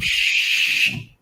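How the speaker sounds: urgent whispered shh; villagers shushing. Acted. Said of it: "they start trying to shush you".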